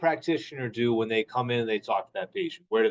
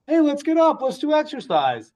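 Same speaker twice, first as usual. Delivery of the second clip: chirpy therapist; 12 semitones higher, 4 dB louder than usual. Retold or imitated. Therapist voiced by surgeon.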